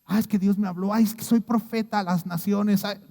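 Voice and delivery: a touch of a pompous self-proclaimed prophet; lightly puffed-up boasting; mock self-important. A believer claiming to be prophet, mild mock pride. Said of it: "No se trata de eso".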